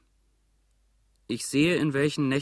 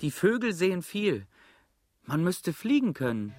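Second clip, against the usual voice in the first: dreamy other voice; 6 semitones higher than usual. Acted. Second voice dreams of flying.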